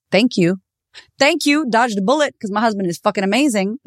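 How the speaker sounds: high-pitched, jubilant thank-you; gleeful relief. Real, not acted. Speaker celebrating a dodged bullet after breakup, gleeful.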